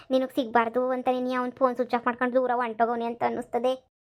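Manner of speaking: ordinary talk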